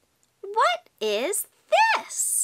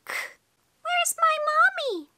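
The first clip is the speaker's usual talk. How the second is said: high, slow little duckling voice; baby duck; 12 semitones higher, slower than usual. Pretend. Baby duck looking for its mommy, high little voice. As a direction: ask it as a tiny lost duckling. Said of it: "She's just a baby".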